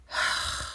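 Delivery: long tired breathy sigh; exasperated sigh. Real, not acted. Fed up with the couple's fighting, exasperated sigh. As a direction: let out a worn-out sigh.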